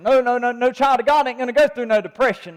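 scoffing imitation of churchgoers who deny depression; a hint of mock church-folk denial; mocking church talk. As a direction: mimic that attitude with a touch of scorn.

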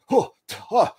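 high-pitched, incredulous mock scoff; imitated scoffing. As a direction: scoff in disbelief, imitating an opponent. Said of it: "like, scoffing".

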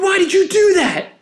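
high-pitched, hurt outcry; imitated angry protest. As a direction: imitate an injured friend cursing you out. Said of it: "And he's cursing at me".

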